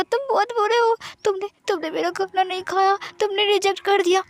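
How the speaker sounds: put-on high, pouty childlike whimper; high pitched tearful complaint; whiny crying. A narrator's reading. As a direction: sulk and whine like a child about to cry. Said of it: "म्यान म्यान मुँह फुलाकर रोने लगती है".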